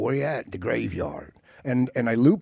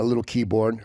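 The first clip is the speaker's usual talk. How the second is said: normal talking voice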